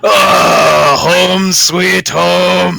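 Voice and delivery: high, loud, drawn-out cry of homecoming; joyful bellow. Acted. Dwarf smelling the forge that feels like home, joyful bellow. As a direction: belt it out long and high.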